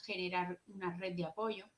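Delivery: usual speaking voice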